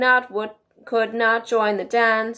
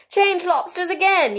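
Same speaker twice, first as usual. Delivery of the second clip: high, excited storybook yell; 10 semitones higher, 6 dB louder than usual. A narrator's reading. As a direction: yell it high, short of full volume. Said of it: "yelled the Gryphon at the top of its voice".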